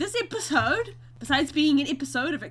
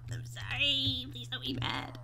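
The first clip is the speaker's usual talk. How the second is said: quiet plea; 8 dB quieter than usual. Real, not acted. Begging the bees quietly.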